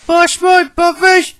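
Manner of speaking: very high, drawn-out engine cry; high-pitched, indignant engine voice; James crying out. A narrator's reading. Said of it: "he cried".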